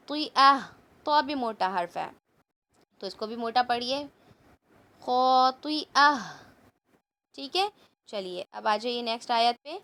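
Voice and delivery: ordinary talk, nothing marked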